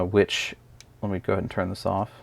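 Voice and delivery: ordinary talk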